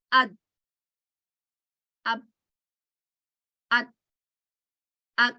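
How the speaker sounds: "ad" cortado en seco, sin rebote; "ad" con la d apagada, sin soltar aire; unbounced dal. Shown as a demonstration. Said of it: "you don't know which letter I'm bouncing".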